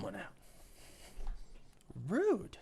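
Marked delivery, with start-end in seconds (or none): 2.1-2.6 mock offended.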